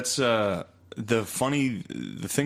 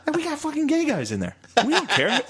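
very high, slowish mock dad voice; imitating dad. Dad defending the club, squeaky mock voice. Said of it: "He's like".

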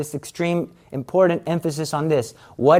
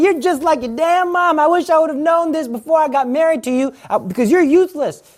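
angry husband; 11 semitones higher than usual. Acted. Husband insults wife.

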